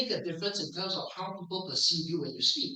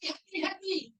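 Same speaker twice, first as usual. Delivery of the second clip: high-pitched Singaporean "Happy, happy"; Singaporean pronunciation; 10 semitones higher, 4 dB quieter than usual. Retold or imitated. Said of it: "In Singapore, we say".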